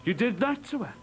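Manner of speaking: a father rounding on his son; angry accusation. Acted. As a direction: accuse him hard. Father confronts violent son.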